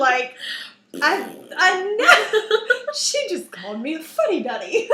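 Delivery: breaking up laughing, high and loud; can't get the words out for laughing. Real, not acted. Recalling her daughter calling her a fuddy duddy, laughing.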